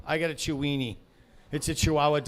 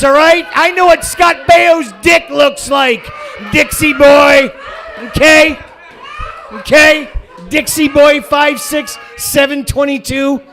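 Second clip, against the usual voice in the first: yelling at the troll, much higher and louder; furious screaming rant; 7 semitones higher, 13 dB louder than usual. Real, not acted. Comic screams at the commenter who says he's not funny, furious shout. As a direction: scream every word at him.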